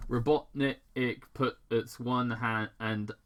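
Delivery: usual speaking voice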